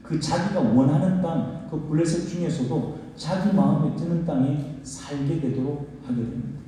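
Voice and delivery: normal talking voice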